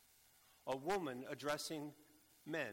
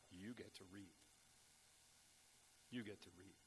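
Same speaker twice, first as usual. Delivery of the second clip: hushed promise; 6 semitones lower, 14 dB quieter than usual. Acted. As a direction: drop much softer, lower. Quiet promise to disciples.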